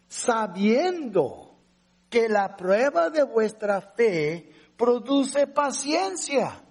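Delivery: slow, measured reading of the verse; solemn scripture reading. Acted. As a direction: lay out each word of Scripture slowly. Reads verse three aloud. (lectura solemne y pausada del versículo)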